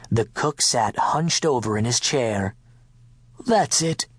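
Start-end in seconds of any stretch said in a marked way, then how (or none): none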